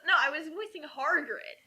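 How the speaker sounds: normal talking voice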